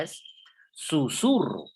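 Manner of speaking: usual speaking voice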